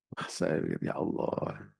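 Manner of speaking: whispered "Ya Allah", soft and breathy; hushed dismay. Retold or imitated. Preacher dismayed at the greedy fee, whispering. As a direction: sigh it out under your breath.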